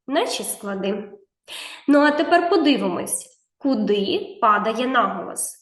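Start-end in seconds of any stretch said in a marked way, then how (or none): none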